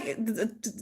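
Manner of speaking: usual speaking voice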